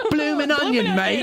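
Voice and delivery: very high, silly Aussie voice; hammed-up fake Australian; bad Australian accent. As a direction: squeeze out a mock Australian catchphrase. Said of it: "I can't do an Australian accent".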